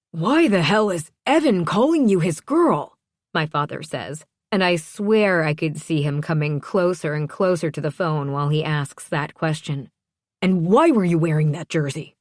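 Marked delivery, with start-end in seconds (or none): 0.1-3.0 loud, raised, outraged demand; indignant, pitch and volume up; angry father. A narrator's reading. Angry father confronts daughter. 10.4-12.2 loud, accusing question; raised voice, demanding an answer; angry father. A narrator's reading. Father keeps interrogating daughter.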